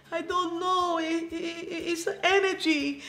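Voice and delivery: put-on high, slow Transylvanian-accented English, haltingly stretched. Wife retelling his tearful moment at church, imitated Transylvanian accent. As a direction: imitate his Dracula-like accent, searching for words. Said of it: "tiene el acento así, como Transylvanian".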